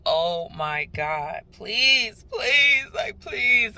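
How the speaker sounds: loud, rising begging at a flashing light; panicked pleading. Retold or imitated. Stuck at the light, panicking, loud pleading. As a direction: beg the light to let you through. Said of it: "I'm panicking right now".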